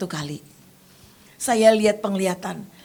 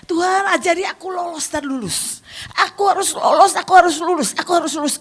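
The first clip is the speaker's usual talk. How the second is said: a fervent, rising vow; pleading prayer; 8 semitones higher than usual. Retold or imitated. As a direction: beg God to help you pass the test. Praying alone upstairs, resolving.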